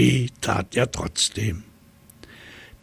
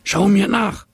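much higher and louder monkey call; chattering monkey; 11 semitones higher, 6 dB louder than usual. Acted. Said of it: "schnatterte er". (hohe, laute Affenrufe)

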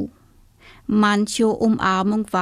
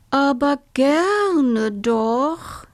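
slow, higher, drawn-out giraffe voice; 5 semitones higher, much slower than usual. Acted. Giraffe happily agrees to the toad's hug, slow. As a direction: stretch it like a long neck.

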